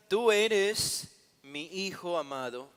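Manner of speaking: a measured, lightly acted divine declaration; lightly voiced heavenly voice. Preacher quoting the voice from heaven at Jesus' baptism, lightly proclaimed. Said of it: "vino una voz del cielo que decía".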